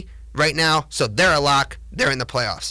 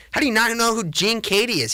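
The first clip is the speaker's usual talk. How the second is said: exasperated rant; 7 semitones higher than usual. Real, not acted. Fired-up rant.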